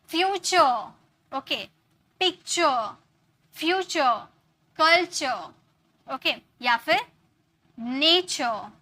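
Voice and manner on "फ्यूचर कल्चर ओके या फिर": ordinary delivery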